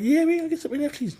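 very high, quick mocking squeal; squeaky mock enthusiasm; mock excitement. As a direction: imitate an over-eager hype crowd. Mocking eager NFT buyers.

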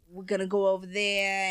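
put-on New York accent. Trying out the famous accent, New York accent. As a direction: play a New Yorker. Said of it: "New York is the most famous accent".

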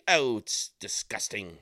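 bad British accent, slow and drawn out; Simon Cowell impression. Vlogger mocking a talent show judge, in a terrible British accent. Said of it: "That was a terrible accent".

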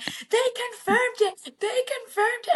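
hammed-up fan squeal at the breaking news; frantic TikTok commenter. Podcaster imitates TikTok fans reacting to the news, squealing. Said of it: "being like".

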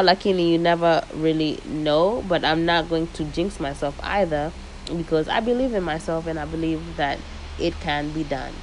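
ordinary delivery

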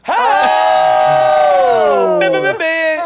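a big hyped-up cheer; sudden happy yell; loud cheer. Real, not acted. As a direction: shout it out with a laugh. Cheering at joke.

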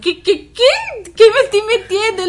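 high, loud retold disbelief; surprised. Reacting to the girlfriend's message, high surprised disbelief. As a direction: act out the shock. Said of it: "Y yo".

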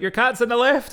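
alarmed call. Retold or imitated. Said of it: "she was like".

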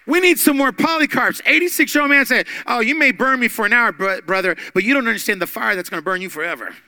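loud, high fervent preaching; preaching at full force. Real, not acted. Pastor exhorting congregation about Polycarp, fervent preaching. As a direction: drive it home to the congregation.

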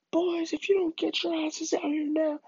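high, strained threatening call; angry dad. Retold or imitated. Upset dad summoning his sons, high strained threat. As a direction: imitate a father ordering his kids out.

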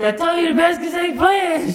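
put-on shrieked, very high kid's protest; hammed-up frantic squeal; panicked protest. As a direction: shout it up high in mock panic. Child protesting to aunt.

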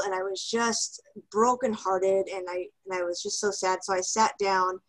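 normal talking voice

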